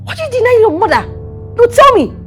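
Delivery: loud, high, fast challenge; angry demand. Acted. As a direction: demand an answer heatedly. Scolding a daughter.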